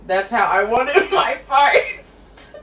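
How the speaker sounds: high, loud, drawn-out insistence with a laugh; playful loud protest. Real, not acted. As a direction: whine it loudly, half laughing. Playful protest about copied hairstyle.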